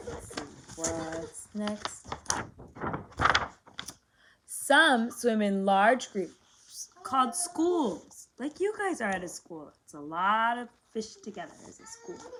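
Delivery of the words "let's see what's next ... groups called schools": usual speaking voice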